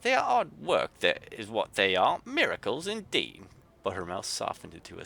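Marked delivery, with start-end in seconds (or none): 0.0-3.5 put-on thick Yorkshire accent, much higher and louder, grumbling; a cross Yorkshire artificer grumbling; Yorkshire grumble. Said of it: "in a thick Yorkshire accent".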